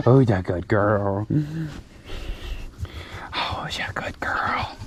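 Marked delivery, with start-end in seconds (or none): 3.3-4.8 tender whispered pet talk; whispering. Real, not acted. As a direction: whisper it softly to her. Cooing to a goat.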